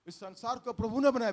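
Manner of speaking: normal talking voice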